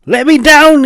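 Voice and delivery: high, loud angry snarl; high-pitched snarling shout. Acted. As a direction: yell it out furious, hanging upside down. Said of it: "he snarled".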